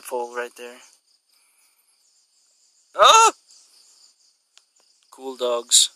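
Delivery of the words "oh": loud, high yelp of surprise; high startled "oh". Real, not acted. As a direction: let out a sudden falsetto exclamation. Reacting to the distant dog.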